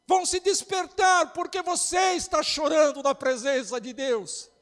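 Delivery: loud, high, rising pastor's cry; fiery preaching. Real, not acted. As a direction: preach it out to the whole church, building. Preaching at full force over music.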